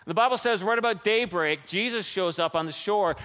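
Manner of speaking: usual speaking voice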